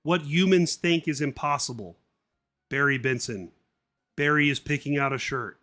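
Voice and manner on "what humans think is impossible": ordinary delivery, nothing marked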